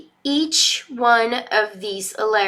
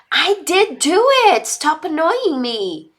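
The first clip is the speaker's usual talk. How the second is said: put-on annoyed child; 7 semitones higher, 6 dB louder than usual.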